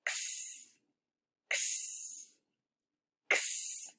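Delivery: x as a breathy unvoiced ks hiss; x sound. Shown as a demonstration. Teacher modelling the letter sound for kids, a whispered ks. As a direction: make x as a soft k then s, no voice. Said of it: "the sound that X can represent is".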